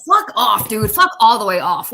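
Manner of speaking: loud, heated outburst; angry dismissal. Real, not acted. Ranting at the politician about veterans, angry dismissal. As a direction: tell him off forcefully.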